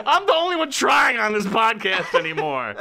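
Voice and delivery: high laughing protest. Real, not acted. Laughing at his own pun.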